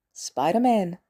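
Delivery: plain reading voice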